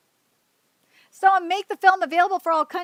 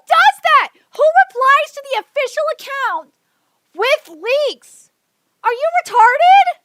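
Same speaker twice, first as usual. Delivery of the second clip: higher, louder exasperated yelling; angry rant; 6 semitones higher, 5 dB louder than usual. Real, not acted. Streamer yells at fans replying with leaks, angry. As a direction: blast them, fed up.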